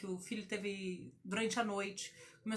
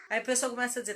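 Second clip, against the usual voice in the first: ordinary delivery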